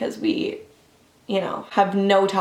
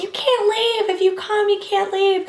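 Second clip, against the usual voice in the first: much higher imitated yell from a doorway; mock yell; 12 semitones higher than usual. Jake's mom yelling after them from the door, high mock yell. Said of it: "yelling out the door".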